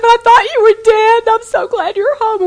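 high, loud wail of relief, imitated; retold tearful mother. Mother welcoming home husband she thought dead, imitated tearful outburst. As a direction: act out a mother overcome with relief. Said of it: "We were all crying".

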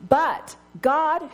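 "But God" lifted high with God stressed; emphatic "But God". Acted. Preacher to the congregation, stressing "But God". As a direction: throw weight onto "God". Preacher turning on the key words.

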